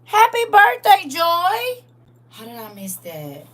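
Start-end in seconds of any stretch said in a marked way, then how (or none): 0.0-1.8 high, loud happy birthday call; excited birthday shout; birthday cheer. Real, not acted. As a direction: sing it out to Joy, bright. Greeting Joy on her birthday.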